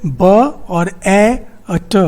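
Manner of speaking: "ब", "ए", "ट" each isolated, at a slow pace; separate sounds. Shown as a demonstration. Teacher counting sounds in bat, ब, ए, ट said separately. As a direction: sound out ब, ए, ट one by one. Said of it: "यहाँ पे कितना है".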